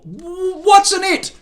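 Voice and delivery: high, slow eager blurt. A narrator's reading. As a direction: burst out unable to hold it. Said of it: "he blurted".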